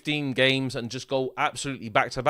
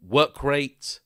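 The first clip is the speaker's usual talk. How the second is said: normal talking voice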